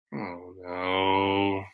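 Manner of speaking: usual speaking voice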